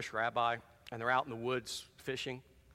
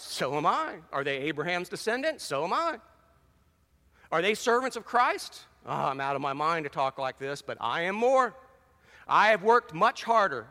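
forceful, rising scripture reading, louder; fiery sermon reading of Paul's sufferings; 4 semitones higher, 4 dB louder than usual. Acted. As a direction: preach Paul's boast with mounting force.

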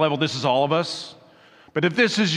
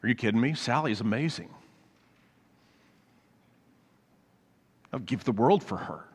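sincere devotion; 5 semitones lower, 7 dB quieter, faster than usual. Retold or imitated. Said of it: "he goes".

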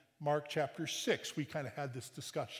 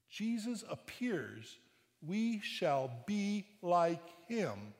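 raised, slowing scripture read; solemn scripture reading; 4 semitones higher, 4 dB louder than usual. Acted. Preacher reading First John to the congregation, solemn and raised. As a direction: read the verse out with weight.

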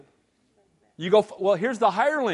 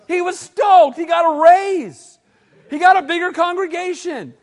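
loud, high thrilled call; preacher relishing the priest's raise; excited shout; 5 semitones higher, 5 dB louder than usual. Real, not acted. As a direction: sell the excitement. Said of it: "preacher excited".

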